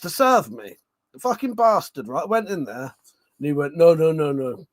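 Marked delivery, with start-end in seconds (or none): 3.8-4.6 shopkeeper's refusal in a put-on Welsh accent, louder; a slipped-in Welsh lilt on the no's; mock Welsh accent. Imitating the corner shop man refusing in a Welsh accent. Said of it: "I may have been putting on an accent".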